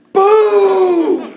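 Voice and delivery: huge, high, held boom; explosive mock gunshot; shouted gunshot boom. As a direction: imitate a rifle going off at full volume. Replaying the rifle shot.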